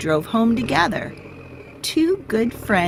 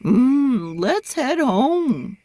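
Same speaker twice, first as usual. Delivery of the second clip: slow, drawn-out cow voice; sleepy cow; 6 semitones higher, much slower than usual. A narrator's reading. Cow suggests heading home, slow and drowsy. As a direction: hum and stretch it like a drowsy cow. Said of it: "said Cow".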